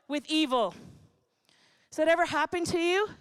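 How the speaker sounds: ordinary talk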